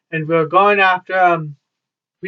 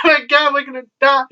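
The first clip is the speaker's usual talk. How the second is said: high, fast mock panic; imitating friend freaking out; 6 semitones higher, faster than usual. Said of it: "she's freaking out with cops and everything".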